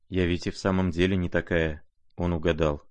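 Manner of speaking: usual speaking voice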